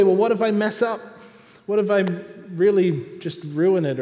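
high, loud anxious questioning; worried believer. Acted. Believer afraid to mess up, acted loud worry. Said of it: "We come and we say".

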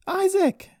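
very high, loud scream; screamed name. A narrator's reading. Daughter running towards the suspect's door, screaming. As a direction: scream his name across the lot. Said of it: "she screamed as she ran".

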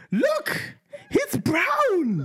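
shrill high mocking squeal with laughter; mock her in a squeaky voice. Husband mocking wife's coo, laughing. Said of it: "And she's like".

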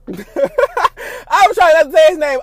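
slow words through real laughter; laughing. Real, not acted. Confessing to viewers she slipped, laughing through the words.

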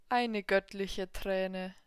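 very slow, savouring. Acted. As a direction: relish every word slowly. Devil savours the tear.

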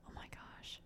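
breathless unvoiced whisper. Real, not acted. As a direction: whisper it under your breath. Whispered while reading.

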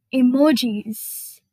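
ordinary talk, nothing marked

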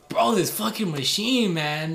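high, loud, drawn-out gripe at a machine; feigned frustration. Acted. Kid ignoring the cop's question, feigned frustration. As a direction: curse the game slowly to look innocently absorbed. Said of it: "I was pretending like I was focused on the game".

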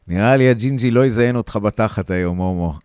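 jeering shout. Acted. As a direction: shout the taunt across the alley. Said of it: "אני שומע את הערבי צועק".

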